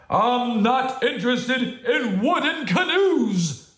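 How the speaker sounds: slow, high, loud bear protest. Acted. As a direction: boom it out indignantly. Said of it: "Bear's voice was even louder".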